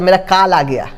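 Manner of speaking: "कॉल" said as kaal, with pure aa; wrong aa vowel. Shown as a demonstration. Teacher mimicking a mispronunciation, "कॉल" as kaal. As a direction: open the vowel of "कॉल" to a long aa. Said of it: "ये नहीं बोलना होता है".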